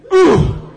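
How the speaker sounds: whooping ooh; high loud whoop. Shown as a demonstration. Exercise cheer.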